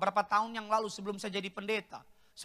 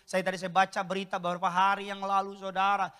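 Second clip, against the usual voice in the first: ordinary talk, nothing marked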